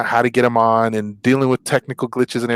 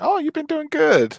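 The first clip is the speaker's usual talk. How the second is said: much higher, sing-song dentist praise; mock dentist praise. Joking about a dentist praising a pre-cleaned patient, mock sweet. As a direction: coo like a pleased dentist. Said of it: "the dentist is like".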